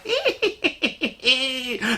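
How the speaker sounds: high-pitched tittering laugh; drawn-out squeaky hee-hee; gleeful giggle. Retold or imitated. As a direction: snicker in a high voice at someone's comeuppance. Teller acts out her gleeful reaction.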